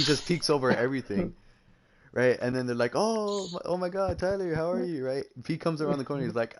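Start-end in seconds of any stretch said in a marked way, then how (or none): 2.9-5.1 mock gushing greeting. Acted gushing greeting from relatives.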